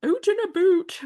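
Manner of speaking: exaggerated Canadian accent, out and about. Shown as a demonstration. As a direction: ham up the Canadian "oot". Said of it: "funny Canadian accent".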